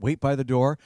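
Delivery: normal talking voice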